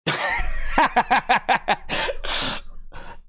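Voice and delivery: long, high cackling laugh. Real, not acted. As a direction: burst into a drawn-out laugh. Bursts out laughing.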